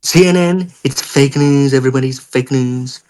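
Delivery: mock American president declaring things; hammed-up presidential impression; Donald Trump. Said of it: "of Donald Trump".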